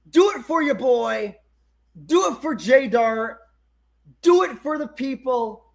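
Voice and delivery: high, emphatic hype-up exhortation; rallying yell. Real, not acted. Streamer urging viewers to like the stream, rallying yell.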